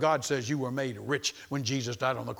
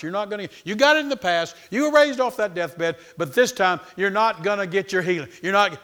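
lightly acted devil, higher and louder; the devil, taunting; 4 semitones higher, 6 dB louder than usual. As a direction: jeer at him insistently. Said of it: "the devil spoke to his mind".

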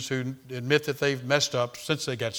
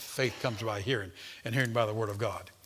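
hushed scripture quote; 6 semitones lower, 9 dB quieter than usual. Acted. Quoted scripture dropped low.

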